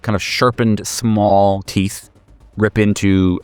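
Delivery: usual speaking voice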